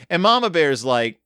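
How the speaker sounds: usual speaking voice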